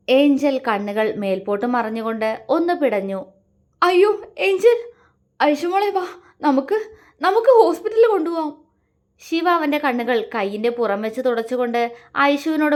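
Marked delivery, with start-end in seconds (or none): 3.8-8.6 high, loud, frantic pleading; panicked. A narrator's reading. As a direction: rush the words in desperate panic. Brother panics over bleeding sister. (പരിഭ്രാന്തിയോടെയുള്ള നിലവിളി)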